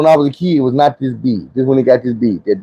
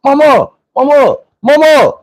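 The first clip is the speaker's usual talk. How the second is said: much higher, louder frantic cries of mama; panicked calling for mama; 13 semitones higher, 4 dB louder than usual. Retold or imitated. Kid with a smashed nose calling for his mama, frantic. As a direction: call out for mama like a scared kid. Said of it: "I bust up in my mama room".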